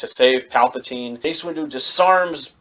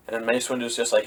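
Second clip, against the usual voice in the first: ordinary talk, nothing marked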